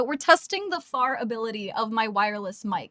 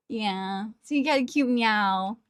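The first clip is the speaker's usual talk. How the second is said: ordinary talk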